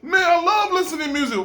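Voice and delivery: high, fast fanboy gush; starstruck fan. Pretend. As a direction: gush like meeting your idol. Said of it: "you be like".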